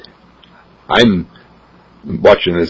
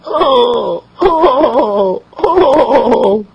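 put-on high cooing baby oohs; high happy baby cooing; delighted baby coo; much slower than usual. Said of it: "he goes".

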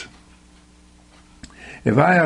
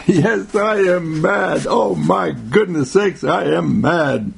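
slow, drawn-out mock outrage; mock anger; 4 semitones higher, slower than usual. Preacher opening his talk by declaring he's mad, mock outrage. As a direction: declare it with exaggerated, stretched-out indignation.

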